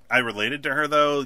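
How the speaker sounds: ordinary delivery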